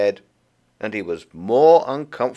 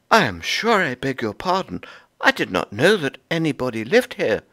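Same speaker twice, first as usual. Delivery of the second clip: a bit higher, polite apology; mildly higher apologetic squirrel; Goody Tiptoes; 7 semitones higher than usual. Acted. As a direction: beg pardon in a higher squirrel voice.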